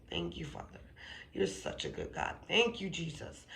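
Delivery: softly murmured prayer of gratitude; hushed thanks. Retold or imitated. Woman retelling her tearful prayer to the Lord, hushed thanks. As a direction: drop to a near-whisper to thank God.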